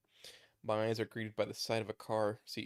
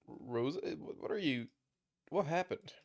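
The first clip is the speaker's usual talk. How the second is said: in pain; 5 semitones higher than usual. Acted. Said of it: "Damn, it hurts to talk".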